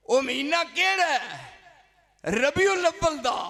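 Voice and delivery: high, loud sermon climax; fiery preaching. Acted. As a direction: preach it with rising fervour. Preaching at full force.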